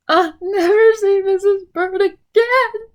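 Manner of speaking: high, loud sobbing wail; crying. Acted. Mister Bird flying off in the rain, sobbing wail. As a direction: cry it out in grief. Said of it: "he cried".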